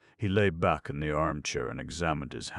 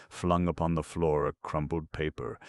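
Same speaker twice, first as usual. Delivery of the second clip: plain reading voice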